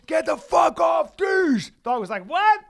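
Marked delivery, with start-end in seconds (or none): 0.1-1.7 high, loud drawn-out shout; furious yell. Retold or imitated. Owner kicks at dog latched on his pet, loud slow yelling. Re-enacting breaking up dog fight. 2.2-2.6 high, loud mock "what"; unfazed dog's retort. Kicked dog answers its attacker, high loud comic question.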